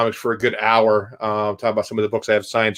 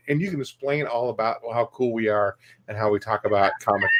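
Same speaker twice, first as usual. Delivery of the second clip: ordinary delivery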